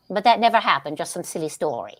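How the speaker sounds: ordinary talk, nothing marked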